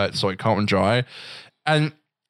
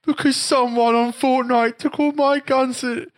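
put-on high, drawn-out wail of a kid; mock crying; slower than usual. Cousin's son explains his tears, imitated high crying. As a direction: imitate a tearful child whining slowly, way up high.

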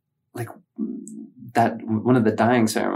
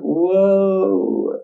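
long, rising high-pitched whoa; woozy drunk; 9 semitones higher than usual. Retold or imitated. Drinker standing up after twenty-one beers, woozy wail. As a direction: stretch it out as if tipping over. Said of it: "twenty-one beers and you stand up".

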